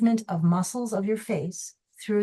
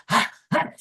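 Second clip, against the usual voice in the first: short, louder voiced sighs on the out-breath; vocalized exhale; 3 semitones higher, 4 dB louder than usual. Shown as a demonstration. Teacher shows the odd gym breathing, louder voiced exhales. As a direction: sigh it out aloud, half joking about the gym. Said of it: "to go".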